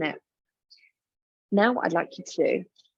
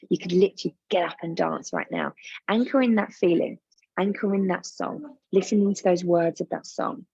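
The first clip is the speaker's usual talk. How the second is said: usual speaking voice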